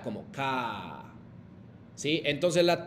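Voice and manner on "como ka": ka grave y lento, imitando cómo lo decían; imitando a los alumnos. Retold or imitated. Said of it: "Miguel Santamaría, Laura Santamaría, Jimena Santamaría decía". (imitando a los alumnos, "ka" grave y lento)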